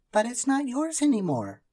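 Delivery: put-on high, loud girl protesting. A narrator's reading. Karen protesting to the greedy magician. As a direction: push back at the magician. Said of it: "protested Karen".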